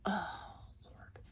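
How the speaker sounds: soft whispered exasperation; whispered aside. Real, not acted. Struggling with the fabric, whispering to herself. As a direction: sigh it out in a whisper.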